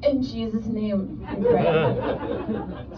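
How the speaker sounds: low, slow, hushed mock prayer; a touch of solemn, drawn-out praying. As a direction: softly intone a mock prayer. Mock bedtime prayer during intimacy.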